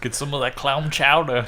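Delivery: bad Boston accent; clumsy hammed-up Boston accent. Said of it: "that was a terrible fucking Boston accent".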